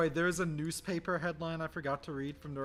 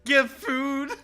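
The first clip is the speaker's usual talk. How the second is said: mock pleading; 8 semitones higher, 7 dB louder, slower than usual. Joking plea after laughing.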